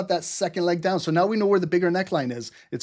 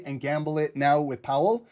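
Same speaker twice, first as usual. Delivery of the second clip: ordinary talk, nothing marked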